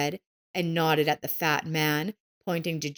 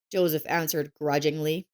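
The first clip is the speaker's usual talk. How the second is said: ordinary delivery, nothing marked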